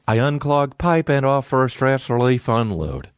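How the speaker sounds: stiff, robotic monotone reading the ad. Retold or imitated. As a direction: speak like a malfunctioning robot.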